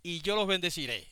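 slow, solemn scripture reading; lectura solemne. Acted. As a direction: read it like holy writ, unhurried. (lectura solemne y pausada)

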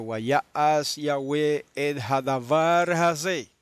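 hadavar hazeh read slowly, syllable by syllable; careful Hebrew reading. Shown as a demonstration. Preacher reading the Hebrew verse to the congregation, very slowly. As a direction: spell out the Hebrew slowly for the listeners. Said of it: "eso en hebreo".

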